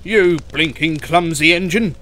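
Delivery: loud, cross fire engine voice; irate character outburst; screeching. Acted. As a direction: scold loudly in outrage. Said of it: "screeched the fire engine".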